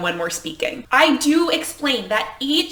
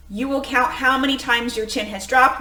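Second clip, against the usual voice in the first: ordinary delivery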